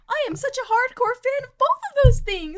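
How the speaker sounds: high-pitched hammed-up fan complaint; mock jealous whine. Envious fan complaining to lucky friend, mock whine.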